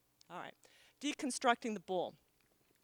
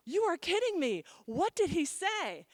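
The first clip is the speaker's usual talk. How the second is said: higher and louder, then stretching the question slowly; eager disbelief; 7 semitones higher, 5 dB louder than usual. Retold or imitated. Hairdresser begging the client for the Pope's words, high eager disbelief. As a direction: gasp it out, dying to hear the gossip. Hairdresser hungry for the Pope gossip.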